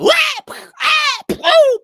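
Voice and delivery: high, loud rapid squawks and cries; high shrieking bird cries; parrots dropping squawks. Acted. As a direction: birds falling one after another. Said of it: "And another parrot".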